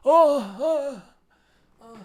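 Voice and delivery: high, loud drawn-out "oh" cries; squealed "oh, oh, oh" imitating Kareem; Kareem sound. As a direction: belt a high ecstatic "oh" three times. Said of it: "it's more of a Kareem sound".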